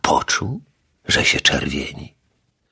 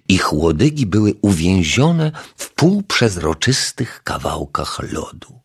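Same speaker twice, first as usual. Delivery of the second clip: plain reading voice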